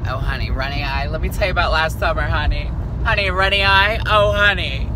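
sassy auntie doting over a runny eye; sassy mock sympathy. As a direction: play the dramatic gossip.

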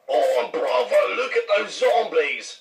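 exaggerated British friend spotting trouble; mock British accent. British co-star in a zombie show, mock British accent. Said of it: "Be like".

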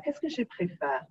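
ordinary talk, nothing marked

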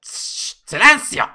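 whispered hush, then a loud shout; shh then a shout. Acted. Judge silencing the courtroom, loud shout.

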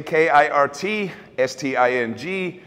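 normal talking voice

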